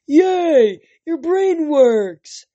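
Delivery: a big simple brother cheering you awake; childlike Cyclops brother. Acted. Tyson the Cyclops speaks.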